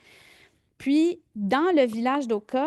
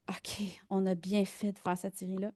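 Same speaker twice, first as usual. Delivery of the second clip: hushed inner thought; 6 dB quieter than usual. Retold or imitated. Filmmaker recalling her realization about the series, hushed inner thought. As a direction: say it under your breath, as if thinking.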